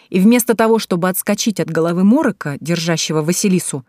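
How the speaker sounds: ordinary delivery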